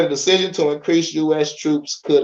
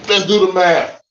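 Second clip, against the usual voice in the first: louder announcement of the task; announcing; 4 semitones higher, 4 dB louder than usual. Shown as a demonstration. Rallying viewers to work out troop numbers, an announcing call. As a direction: call it out to get going.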